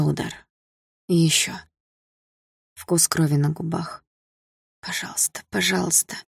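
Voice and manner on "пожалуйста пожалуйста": breathless whispered begging; whispered plea. Acted. Beaten girl silently begs help to come: whispered plea. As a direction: whisper it like a prayer.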